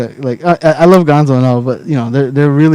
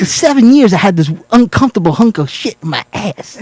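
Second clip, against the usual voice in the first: odd halting cadence, lightly done; Christopher Walken impression; 3 semitones higher than usual. Ending the watch speech, light impression. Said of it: "you gotta get his cadence".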